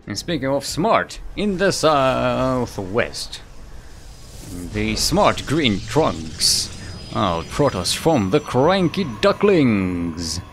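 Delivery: drawn-out, punchy ring-announcer intro; big esports announcer voice, slow on the names; match announcer. Acted. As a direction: introduce the players like a fight announcer, stretching the names.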